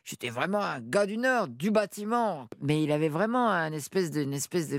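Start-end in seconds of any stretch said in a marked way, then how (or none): 0.0-2.5 put-on northern French accent. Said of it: "il parlait comme ça".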